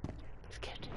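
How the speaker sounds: breathy whisper of fear. Real, not acted. Repeats it, second time whispered.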